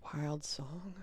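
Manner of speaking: unvoiced searching whisper. Real, not acted. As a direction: whisper it to yourself.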